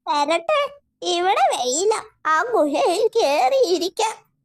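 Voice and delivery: put-on high squeaky old witch, fretting; a cackling witch lost in the forest. Witch speaks. (ഉയർന്ന മന്ത്രവാദിനി ശബ്ദം)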